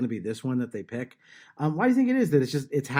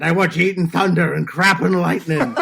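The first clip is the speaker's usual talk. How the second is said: louder, laughing through the words; laughing motivational quote; 3 semitones higher, 6 dB louder than usual. Retold or imitated. Father telling his kids his pep-talk line, laughing. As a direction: laugh as you rally the kids. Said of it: "looked at my children and said".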